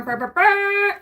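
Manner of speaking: high buzzy burr noise; imitated burr sound. Heard gates opening, imitating a high burr. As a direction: make a high trumpet-like burr. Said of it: "I heard burr".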